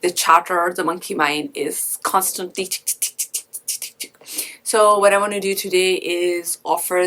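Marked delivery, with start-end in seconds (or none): 2.7-4.5 whispered, voiceless tick-tick-tick; imitated ticking. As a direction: imitate a restless mind ticking away under your breath. Imitates the monkey mind's constant chatter.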